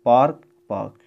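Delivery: पार्क without the r; British park. Shown as a demonstration. Teacher demonstrating British पार्क, no r. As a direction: say पार्क dropping the r. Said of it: "र का उच्चारण नहीं होता है".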